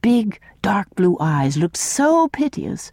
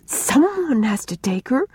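higher, a touch of tearfulness, understated; mild sobbing; 7 semitones higher than usual. A narrator's reading. Una, near tears, insists someone take Mary, mildly tearful. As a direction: let the words catch a little, not full crying. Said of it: "sobbed Una".

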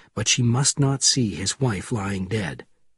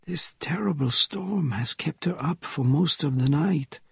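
put-on hushed old Frenchman, higher in pitch; whispered; 5 semitones higher than usual. A narrator's reading. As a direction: whisper it as an elderly French character. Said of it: "he whispered".

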